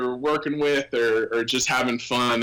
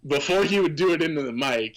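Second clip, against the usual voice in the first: ordinary delivery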